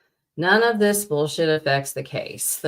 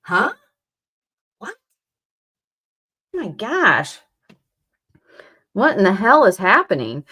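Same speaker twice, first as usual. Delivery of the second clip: ordinary talk, nothing marked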